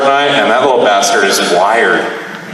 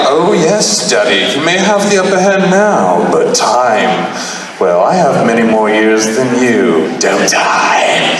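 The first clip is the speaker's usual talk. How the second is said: put-on scheming villain child; slower than usual. Ten-year-old warning his daddy, mock villain menace. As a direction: play a plotting little mastermind. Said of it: "That's some James Moriarty shit".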